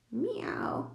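high, lightly voiced kitty meow; cat meow. A narrator's reading. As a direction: mew like a cat eyeing milk. Cat meows at the milk.

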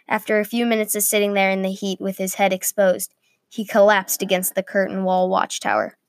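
plain reading voice

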